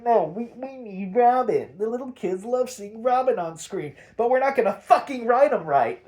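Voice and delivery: high, loud mocking of the writers; sarcastic mock insistence. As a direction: mimic a stubborn writer with scorn. Sarcastically voicing the show's writers.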